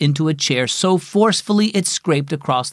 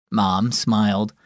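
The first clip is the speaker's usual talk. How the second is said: ordinary delivery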